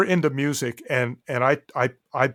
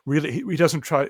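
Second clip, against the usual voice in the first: ordinary talk, nothing marked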